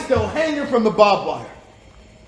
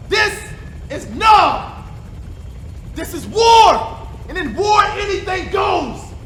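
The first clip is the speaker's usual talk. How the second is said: higher and louder, slowing on the big words; building furious shout; 7 semitones higher, 4 dB louder, slower than usual. Acted. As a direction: hammer each phrase harder. Interrogator ranting about war.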